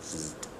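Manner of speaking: soft electric zzzt buzz; a quiet playful zap noise; sparky sound. A narrator's reading. Jim pokes Junie B. with a soft sparky buzz. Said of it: "he made a sparky sound".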